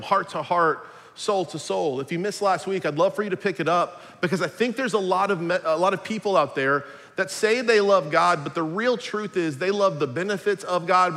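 ordinary delivery

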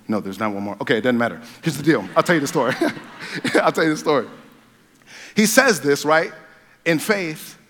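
ordinary delivery

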